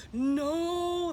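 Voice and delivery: high shouted no; crowd yell. A narrator's reading. Crowd answers Pip, refusing to let Big Bark be lunch, shouted no. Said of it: "the crowd yelled".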